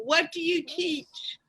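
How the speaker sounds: ordinary delivery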